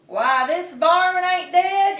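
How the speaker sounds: high, loud, slow squawk; squawking buzzard. Acted. Buzzard surprised the possum lives, squawking loud. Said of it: "it squawked".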